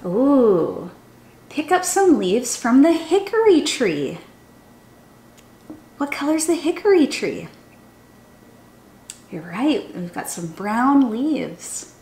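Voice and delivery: lively, sing-song storybook reading; animated children's story voice. A narrator's reading. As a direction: read it like a chant for little kids. Reads a picture book aloud.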